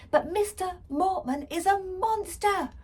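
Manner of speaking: put-on desperate girl crying out. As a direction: beg them to believe. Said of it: "I cried".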